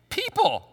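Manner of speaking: People leapt high in pitch; spring up on People; emphatic answer. Real, not acted. Preacher answers own question.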